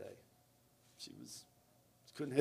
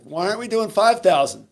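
higher, louder indignant challenge; pissed off; 7 semitones higher, 6 dB louder than usual. Retold or imitated. Swimmer challenging coach over a shortened set, pissed off. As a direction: imitate an annoyed athlete demanding why. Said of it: "pissed off. She's like".

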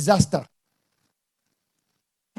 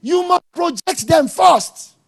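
slow, higher and louder, each word driven home; emphatic slow command; 7 semitones higher, 4 dB louder, much slower than usual. Acted. Preacher commanding fathers to put the family first, slow and emphatic. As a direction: hammer the point home slowly. Preacher stressing the key point.

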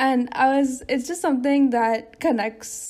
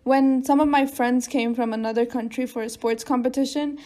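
ordinary talk

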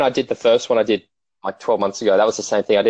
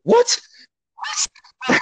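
much higher, stunned double what; shocked disbelief. Retold or imitated. Rider seeing his FTP result, high mock shock. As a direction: squeak it out in disbelief. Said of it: "It's like".

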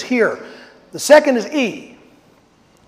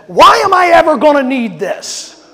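frustrated student; 5 semitones higher, 6 dB louder than usual. Retold or imitated. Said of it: "one of the kids finally just said".

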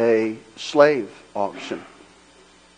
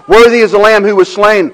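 Worthy belted, high and loud; preaching at full force; 10 semitones higher, 12 dB louder than usual. Acted. Pastor proclaiming Worthy to the church, full-force preaching. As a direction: shout out Worthy like a hymn of praise.

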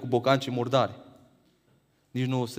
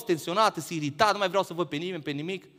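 ordinary talk

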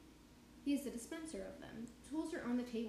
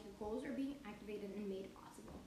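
normal talking voice

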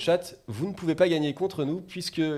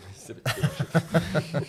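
laughing at own slip; 3 semitones higher, much slower than usual. Real, not acted. Host corrects a slip, laughing.

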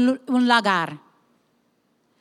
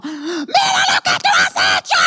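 retold angry shout; 3 semitones higher than usual. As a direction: snap it out like a scolding leader. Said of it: "yo entro y yo digo". (grito de reproche contado)

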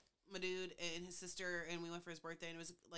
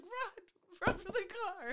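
high, loud urgent cry; high mock panic cry; mock panicked run; 12 semitones higher, 6 dB louder, slower than usual. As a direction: yell it to a friend while fleeing. Fleeing the dad.

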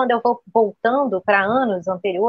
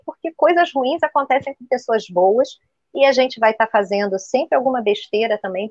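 ordinary talk

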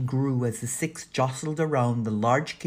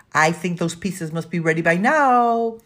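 raised voice climbing toward the end; loud distraction; 5 semitones higher, 8 dB louder than usual. A narrator's reading. Paul interrupts to distract the housekeeper, loudly. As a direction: announce it loudly to change the subject.

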